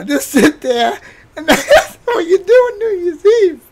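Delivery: very high wailing speech through tears of laughter; crying with laughter. Real, not acted. Mocking friend's New Year's Eve, crying with laughter. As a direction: talk while laughing so hard it hurts.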